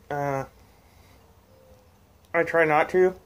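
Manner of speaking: talking around pipe stem; pipe in mouth. Real, not acted. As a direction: teeth clenched on stem. Seen 1: Pipe stem held between teeth, hanging free, throughout the labelled speech.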